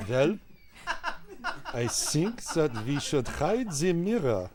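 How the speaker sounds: put-on slow, deliberate German accent. Warm-up leader repeating the student, slow German accent. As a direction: echo it in measured mock German. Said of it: "I'm gonna do German".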